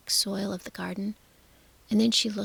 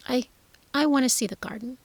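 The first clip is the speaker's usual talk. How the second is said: voice cracking; 4 semitones higher, 4 dB louder, faster than usual. Acted. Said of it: "he whispered, his voice cracking".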